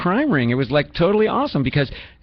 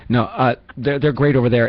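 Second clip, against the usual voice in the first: ordinary delivery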